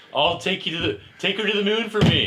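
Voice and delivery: high, loud hammed-up character quote; mock clown voice. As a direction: ham up the cartoon friend's line. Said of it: "Bobo the Clown was like".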